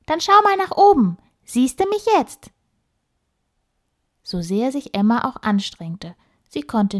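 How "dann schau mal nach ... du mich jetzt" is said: high squeaky little animal voice; tiny bossy critter, high and quick. Acted. The squirrel Flitzi teases Emma. (piepsige Stimme)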